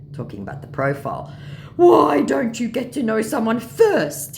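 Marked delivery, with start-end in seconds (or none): 1.8-4.4 high, loud, slow scammer plea; pleading mock scammer. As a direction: draw out the scammer's reproach. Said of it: "he said".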